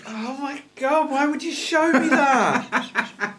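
high, slow horrified laughing groan; disgusted laughter. Real, not acted. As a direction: laugh in revolted disbelief. Reacting to snake poop picture.

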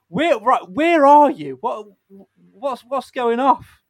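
loud, high call to a guest who joined; calling out loudly. Real, not acted. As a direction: shout out to find him. Host calls out to joining guest.

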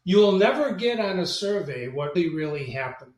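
ordinary delivery

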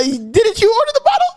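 high, squeaky acted-out question; nervous mock question. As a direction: ask it high, as if caught out. Acts out her nervous question back.

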